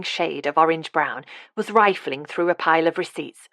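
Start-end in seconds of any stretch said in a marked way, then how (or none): none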